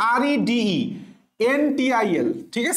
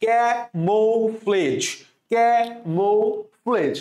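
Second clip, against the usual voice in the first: slow teaching demo of camouflage; slow careful pronunciation; much slower than usual. Shown as a demonstration. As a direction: stretch out camouflage slowly, every syllable clear. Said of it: "কি উচ্চারণ করবো আমরা?".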